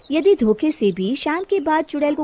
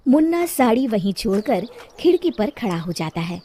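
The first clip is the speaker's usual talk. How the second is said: usual speaking voice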